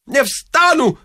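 high-pitched wailing refusal; stubborn priest. A narrator's reading. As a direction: refuse in a high, pleading cry. Priest stubbornly refuses, louder plea. (упрямо, высоким голосом)

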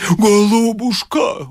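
high, drawn-out gushing endearment; tenderly moved. A narrator's reading. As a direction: coo it high and slow, overcome. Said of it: "умилился отец Фёдор". (умилённо, протяжно и тонко)

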